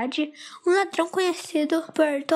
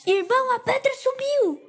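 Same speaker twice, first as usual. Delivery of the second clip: high panicked cry; desperate; 11 semitones higher than usual. A narrator's reading. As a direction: blurt it out in a panic. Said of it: "fala Midas desesperado".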